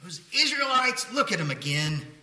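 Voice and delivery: exasperated groan sinking into reluctant consent; begrudging. Acted. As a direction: grumble loudly, then grudgingly give in. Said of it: "He isn't begrudgingly like".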